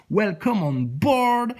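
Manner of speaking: slow, high, sugary cabin announcement; flight attendant voice. Retold or imitated. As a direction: parody a flight attendant greeting passengers. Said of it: "ce ton d'hôtesse de l'air".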